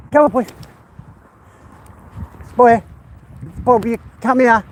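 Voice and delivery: high, loud call after the dog. Real, not acted. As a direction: shout him back to you. Calling the runaway dog.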